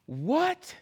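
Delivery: high rising stunned question; astonished what. Pretend. Pastor modelling amazed reaction to grace. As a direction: gasp out the word in disbelief. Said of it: "And you should go".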